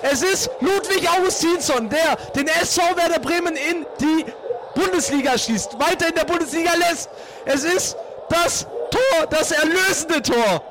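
excited goal call. Real, not acted. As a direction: shout the saving goal. Last-minute winning goal commentary.

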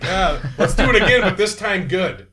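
high, laughing mock director voice; David Lynch impression. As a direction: ham up a fussy director asking for another take.

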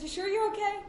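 high-pitched concerned check; imitating concerned partner. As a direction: ask it high, as the worried partner. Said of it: "He goes".

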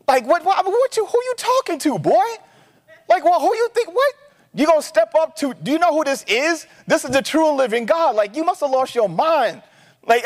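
high, incredulous street-style retort; David's zealous outrage. Retold or imitated. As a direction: voice young David's indignant disbelief at the giant.

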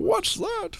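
put-on high, quavering old-timer asking; confused old man. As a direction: squeak it out like a baffled old man. Old man confused about references.

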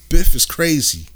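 usual speaking voice

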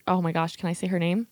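ordinary delivery

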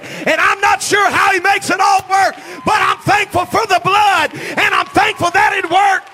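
loud, high fiery preaching, near shouting; full-force preaching. Acted. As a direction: preach it to the back row with fire.

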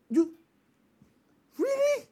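high, drawn-out fake astonishment; mock surprise. Parent faking surprise at a gossiped-about guest, high mock astonishment. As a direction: act amazed, falsely.

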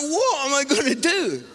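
retold panic. Preacher recalls realising he's the target, mock panic. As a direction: play up the helpless alarm.

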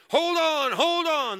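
loud, high hammed-up yell; yelling at him to hold on; screaming. Church lady screaming hold on. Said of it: "everybody's screaming at you".

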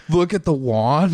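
excited amazement. Retold or imitated. Retelling strangers' excited amazement.

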